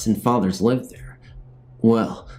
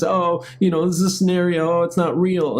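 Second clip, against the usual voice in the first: higher, mock TV host reveal; John the host; 8 semitones higher than usual. Vlogger imitating the show host revealing the setup, higher. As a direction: pop out and reassure like a prank host. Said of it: "he pops out and says".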